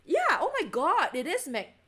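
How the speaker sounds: high, loud thrilled oh my god; excited exclaim. Real, not acted. Showing viewers the magnet pulling the paint, thrilled and loud. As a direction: burst out in delight.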